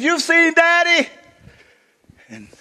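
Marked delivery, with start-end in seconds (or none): 0.0-1.1 put-on high, loud, eager child's question; daughter asking. Daughter asking her mother during hide and seek, high and loud. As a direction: ask it as an excited little girl. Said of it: "She said".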